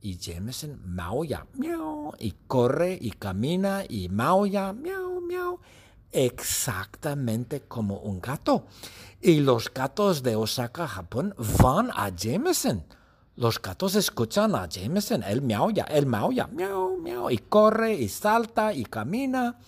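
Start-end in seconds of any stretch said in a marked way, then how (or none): 1.5-2.1 high, soft meow; cat meow. Retold or imitated. Teacher meows softly as Jameson in the cat suit. 4.7-5.6 high, soft meow; cat meow. Retold or imitated. Teacher meows softly as Jameson in the cat suit. 16.5-17.3 high, soft meow; cat meow. Retold or imitated. Teacher meows softly as Jameson in the cat suit.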